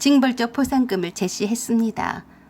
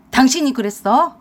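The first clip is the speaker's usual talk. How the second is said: louder accusing shout; 6 dB louder than usual. Acted. Husband shouting at wife, louder. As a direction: yell the accusation. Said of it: "큰 소리를 쳐댔어요".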